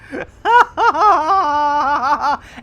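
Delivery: long helpless laugh. Real, not acted. Streamer in fits over the acronym, long laugh. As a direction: laugh until breathless.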